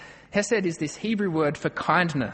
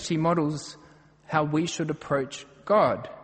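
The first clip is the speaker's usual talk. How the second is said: ordinary talk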